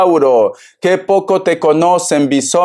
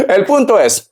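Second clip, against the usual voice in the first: laughing; 11 semitones higher than usual. Real, not acted. Laughs before returning to point.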